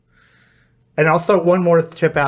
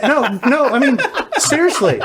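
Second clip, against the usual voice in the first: host laughing off the cohost's joke; laughing protest; 10 semitones higher than usual. Real, not acted. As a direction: insist through a laugh.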